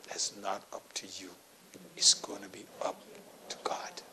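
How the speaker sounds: breathy whispered aside. Real, not acted. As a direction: drop to a whisper for the point. Sermon aside, voice drops.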